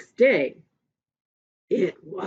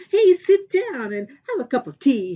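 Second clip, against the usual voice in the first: hearty welcome; 6 semitones higher, 9 dB louder than usual. Acted. Earth King welcomes Porcupine.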